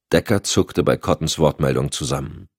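plain reading voice

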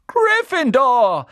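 slow, loud, high proclamation; hat shouting. A narrator's reading. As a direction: boom the house name. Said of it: "rief der Hut". (lauter, langsamer Ruf)

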